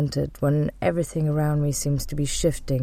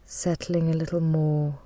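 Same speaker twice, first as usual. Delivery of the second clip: guided meditation. Real, not acted. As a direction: guide them gently into calm, leaving space to breathe.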